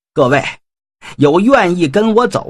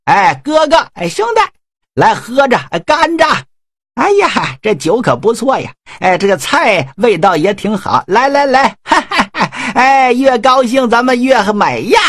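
gleeful taunting generals; 7 semitones higher than usual. Acted. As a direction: laugh it up to needle the grieving brother. Generals taunt with loud merriment.

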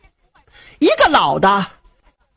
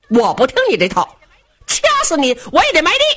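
defiant son; 6 semitones higher, faster than usual. Acted.

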